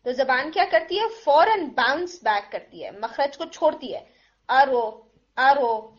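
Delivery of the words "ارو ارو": ارو with the tongue bouncing off, r popped louder; bouncing r demo. Shown as a demonstration. Teacher imitates students' wrong bouncing r in ارو. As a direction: show the wrong ارو where the r bounces back. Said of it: "یہ sound آتی ہے ان کی".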